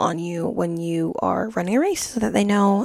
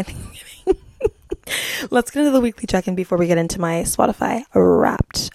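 ordinary talk

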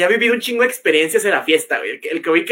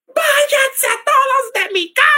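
shrill, very high drawn-out scream; high hysterical yell ordering everyone out; screaming hysterical girl; slower than usual. Retold or imitated. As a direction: scream them out of your house like a furious birthday girl. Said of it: "y gritando".

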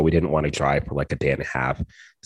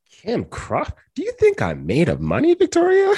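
shrill scolding mom, pitch soaring; scandalized parent, voice climbing high; nagging parent impression. Mock outrage at wine price.

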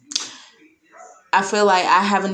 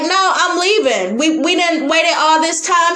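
defiant refusal; 7 semitones higher than usual. Retold or imitated. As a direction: plant your feet and refuse. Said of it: "I was like".

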